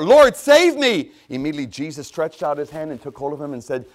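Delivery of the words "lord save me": very high, loud, drawn-out shout for help; desperate cry. Acted. Sinking Peter calls to Jesus, desperate drawn-out cry. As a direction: cry out slowly at full force. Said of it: "And he cried out".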